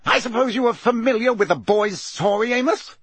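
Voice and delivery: high-pitched, pointed reprimand; indignant, rising challenge; barked. A narrator's reading. Mister Crouch challenges Amos about the boy, indignant bark. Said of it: "barked Mister Crouch".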